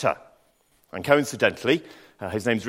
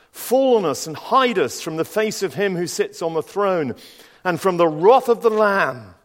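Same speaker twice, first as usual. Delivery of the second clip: higher, louder pleading cry; desperate cry; 7 semitones higher, 4 dB louder than usual. Acted. Kings begging the rocks to hide them, desperate cry. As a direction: beg the mountains to hide you.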